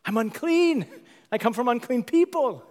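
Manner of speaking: high, loud lament of woe; the prophet wailing his unworthiness, loud and high; anguished prophet's cry. Retold or imitated. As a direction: cry it out like a man undone.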